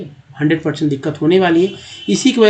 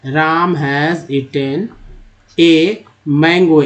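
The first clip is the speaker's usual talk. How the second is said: Ram has eaten a mango read very slowly word by word; slow demonstration of the sentence; slow model reading; much slower than usual. Shown as a demonstration. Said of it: "ऐसे ही पढ़ना है".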